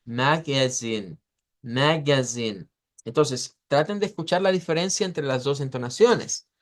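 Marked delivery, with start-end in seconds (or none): 0.0-2.7 "magazine" slow, MAG stressed, falling away low; first-syllable stress. Shown as a demonstration. Teacher shows alternate pronunciation, slow "magazine" stressed on MAG. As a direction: lean on "mag" in "magazine", let the rest drop. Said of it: "la fuerza de voz va aquí".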